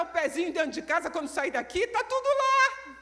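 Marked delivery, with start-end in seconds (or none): 1.9-3.0 high, louder, slow exclamation; exclaiming high. Real, not acted. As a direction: shout it out, amazed at the mess.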